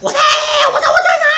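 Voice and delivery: high wailing shout; anguished scream. Pretend. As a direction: cry out in despair. Luffy crying for Ace.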